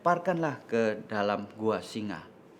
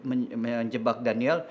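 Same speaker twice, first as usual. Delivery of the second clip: ordinary delivery, nothing marked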